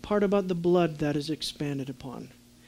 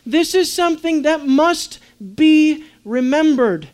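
raised, high-pitched preaching with weighty pauses; emphatic preaching; 8 semitones higher, 6 dB louder, slower than usual. Real, not acted. Preacher stressing what must be kept forever, forceful and emphatic. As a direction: drive each phrase home without mincing words.

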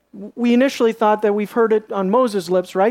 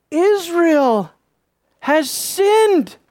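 high, declaimed, with a heavy pause; emphatic indictment; 7 semitones higher, much slower than usual. Acted. God revealing guilt to Joshua, emphatic indictment. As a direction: proclaim the charge word by word, pitch raised.

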